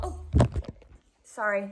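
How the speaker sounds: usual speaking voice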